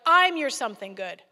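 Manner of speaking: loud, firm declaration from God; God booms it out; booming God voice. Retold or imitated. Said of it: "And God's like".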